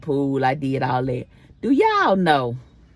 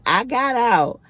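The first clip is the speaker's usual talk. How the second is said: normal talking voice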